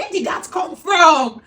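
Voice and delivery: loud, slow, laughing disbelief; shocked disbelief. Retold or imitated. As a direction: blurt it out in shock. Retelling her shocked reaction.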